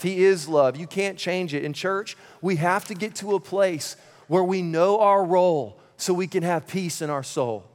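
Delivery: ordinary talk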